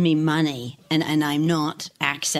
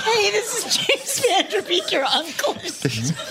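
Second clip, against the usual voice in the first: high, slow, laughing celebrity voice; mock Cameo celebrity; 13 semitones higher, slower than usual. Panelist repeats the Cameo joke in a high laughing voice. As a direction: chirp bad news cheerfully and slow. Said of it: "repeat of Cameo bit".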